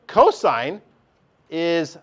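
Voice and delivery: normal talking voice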